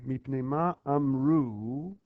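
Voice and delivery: Mipnei ma amru said slowly, word by word; slow read. Shown as a demonstration. Teacher reading the line aloud for the class, slowly. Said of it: "look at this line".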